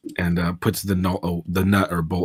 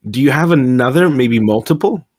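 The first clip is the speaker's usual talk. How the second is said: raised, pleading character voice; 6 semitones higher, 7 dB louder than usual. Acted. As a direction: ask in a higher, louder voice. Player voicing character request.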